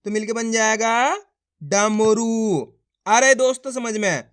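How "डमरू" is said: डमरू very slowly, each syllable held; syllables joined slowly. Shown as a demonstration. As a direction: stretch डमरू out for a beginner reader. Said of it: "बना बना के पढ़ा जाता है".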